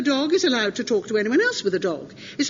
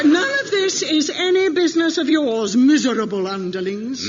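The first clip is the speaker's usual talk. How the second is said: haughty villain; 6 dB louder than usual. Acted. Haughty dismissal of the crew.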